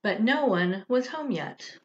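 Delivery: plain reading voice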